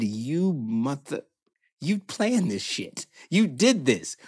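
soft, slow, seething anger; quiet seething. Retold or imitated. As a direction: producer realizing Kevin planned it all.

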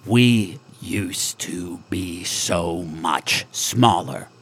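slow, low, intense alien voice; intently. Acted. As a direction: lean in and say it slowly. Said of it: "says extremely intently".

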